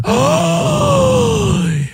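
high gasp of outrage; mock shocked gasp. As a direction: gasp like you're appalled.

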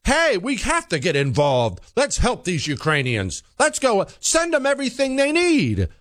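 high-pitched mock yell. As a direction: imitate an urgent crowd yelling, without much extra volume. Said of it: "were, would yell".

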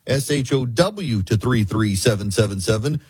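ordinary talk

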